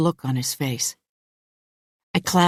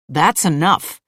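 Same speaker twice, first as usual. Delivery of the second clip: louder, firm command; firm rebuke; 5 dB louder than usual. A narrator's reading. Man refusing Louise's advances, firmly. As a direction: put a stop to it firmly. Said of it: "he says again".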